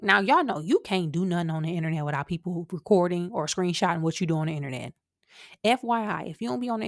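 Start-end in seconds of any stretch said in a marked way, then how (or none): none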